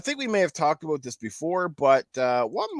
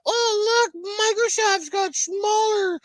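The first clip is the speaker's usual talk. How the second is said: high squeaky mock voice, hammed-up and slow; mocking falsetto; much slower than usual. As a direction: sneer at them in a silly high whine. Mocking the showy exhibitors.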